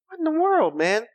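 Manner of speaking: high squeal of disbelief; shocked at the bird-claw image. Real, not acted. Reacting to hair like feathers.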